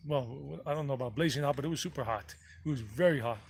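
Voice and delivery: ordinary talk, nothing marked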